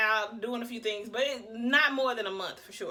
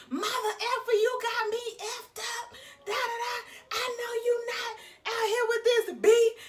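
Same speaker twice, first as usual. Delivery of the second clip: higher, crying furious shouting; furious woman; 10 semitones higher, slower than usual. Retold or imitated. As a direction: scream at the cheating man, voice breaking. Angry woman confronts cheating man.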